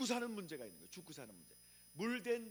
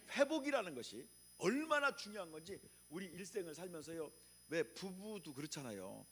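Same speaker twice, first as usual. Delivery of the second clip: ordinary talk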